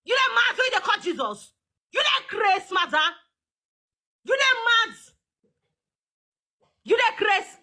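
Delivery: yelling at the madam, pitch up; shouting. Real, not acted. Live-stream host shouting in disbelief at her guest. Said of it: "I'm shouting".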